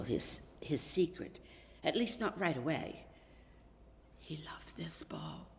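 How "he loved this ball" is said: slow, soft whisper; hushed. A narrator's reading. Narrator lingering on the boy's treasured ball, whispering. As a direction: breathe it like a secret.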